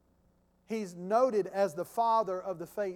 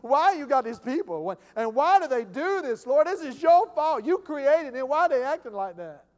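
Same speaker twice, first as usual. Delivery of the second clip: loud, higher complaining prophet voice, pleading with the Lord; whiny prophet; 4 semitones higher, 9 dB louder than usual. Retold or imitated. Pastor imitates Habakkuk complaining to the Lord, loud whine. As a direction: whine at God like a fed-up prophet. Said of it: "whining and complaining".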